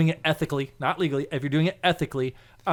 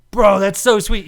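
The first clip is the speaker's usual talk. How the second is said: loud, higher acted delight; excited admiration; 5 semitones higher, 9 dB louder, slower than usual. Storyteller thrilled by coworker's supposed name, excited admiration. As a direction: gush it out, impressed.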